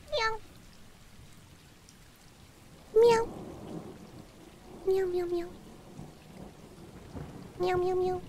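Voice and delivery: small soft kitten meowing; little mimicked meows; pretend cat meows. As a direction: mew back at the cats. Meowing along with game cats.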